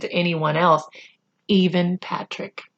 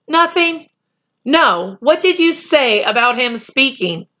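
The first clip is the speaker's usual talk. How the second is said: a higher, curious schoolboy; pressing friend voice; 6 semitones higher than usual. A narrator's reading. Patrick pressing Omri about the Indian, curious boyish voice. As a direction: press him to explain.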